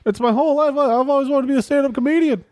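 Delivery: high-pitched hack stand-up comic; wisecracking crucified Jesus. Acted. Dying Jesus justifies joking to visitor, high-pitched wisecracking. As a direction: plead your case like a struggling comedian. Plays Jesus as aspiring comedian.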